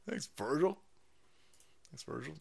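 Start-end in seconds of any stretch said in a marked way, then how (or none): 1.9-2.3 much softer murmured repeat; soft echo. Real, not acted. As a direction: echo it back very softly.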